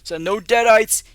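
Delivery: rally the crowd like a bragging candidate; firefighter shouting through mask. Acted. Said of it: "He goes".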